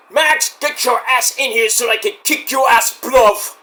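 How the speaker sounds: hammed-up fighting talk; Will Ospreay. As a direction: imitate a British wrestler's promo. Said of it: "He says".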